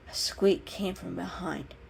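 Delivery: usual speaking voice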